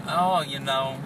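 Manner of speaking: Southern Belle twang. Retold or imitated. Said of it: "that Southern Belle twang thing going".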